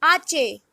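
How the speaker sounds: ache said "AH-cheh"; Spanish letter H. Shown as a demonstration. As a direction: say ache in two syllables, the Spanish way. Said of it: "H is pronounced as".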